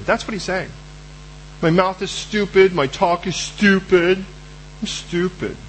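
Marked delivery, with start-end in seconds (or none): 1.6-5.6 slow, sulky self-put-down; slow mopey complaint; self-pitying drawl. Acted. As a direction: drag it out, sulking.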